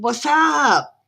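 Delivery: drawn-out, high, loud "what's up"; mock-hearty greeting. Streamer greeting a fellow Aussie, mock-hearty. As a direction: stretch it into a playful high holler.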